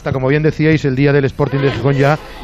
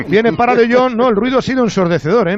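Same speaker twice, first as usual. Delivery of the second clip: rising faster build to the goal; breakneck goal commentary; 8 semitones higher, 3 dB louder than usual. Acted. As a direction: climb in pitch as the shot comes.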